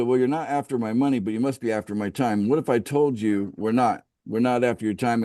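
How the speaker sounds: ordinary talk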